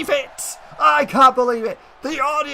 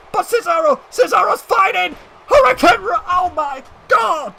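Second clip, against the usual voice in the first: rising, much louder and higher screams of disbelief; excited shout; 5 semitones higher, 4 dB louder than usual. Real, not acted. Commentator shouting to viewers at the Hurricane Rana, excited shout. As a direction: yell it as the move flips. Commentator calls a top-rope reversal.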